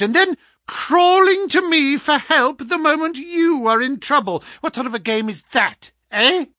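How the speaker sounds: high, loud, scornful tirade; angry, contemptuous. Acted. Visitor rebukes the man begging his help, angrily. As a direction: round on him with rising indignation.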